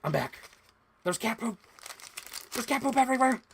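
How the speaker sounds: high, loud mock-frantic announcement; exaggerated streamer imitation. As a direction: build to a comic high shriek. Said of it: "I'm making it sound funnier".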